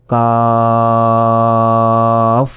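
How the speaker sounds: কাফ held long, tongue a little forward; টেনে কাফ; Arabic kaf drawn out. Shown as a demonstration. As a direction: stretch কাফ slowly. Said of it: "কাফ অক্ষরটি উচ্চারণ হয়".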